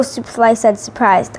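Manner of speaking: ordinary delivery, nothing marked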